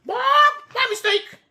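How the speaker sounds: very high, squawky parrot voice; parrot squawk. Acted. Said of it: "squawks the parrot".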